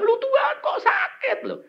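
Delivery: high-pitched mock whining complaint; imitated grumbling. Patient grumbling to God about pain, mock whine. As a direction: act out a patient's tearful moaning.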